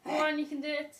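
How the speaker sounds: loud, upbeat push; encouraging shout. Real, not acted. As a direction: cheer them on loudly. Coach pushes client through hold.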